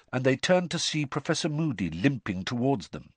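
ordinary delivery

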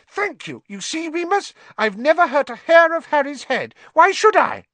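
high, loud, squeaky cry; shrill frightened rat-man squeal. A narrator's reading. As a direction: squeal in terror. Pettigrew grabbing at support.

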